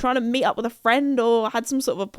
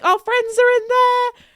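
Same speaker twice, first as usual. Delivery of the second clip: high, loud, drawn-out cry; freaking out; 12 semitones higher, 5 dB louder than usual. Retold or imitated. Knocking on the shop door, panicked call. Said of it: "freaking out".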